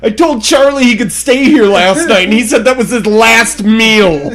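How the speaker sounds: loud, high, laughing retelling. Real, not acted. Telling a friend about Charlie's last meal, laughing. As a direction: laugh through the punchline. Laughing over a shared funny story.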